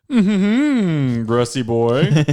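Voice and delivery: high, loud mocking villain. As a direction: taunt him, high and loud. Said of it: "He's like".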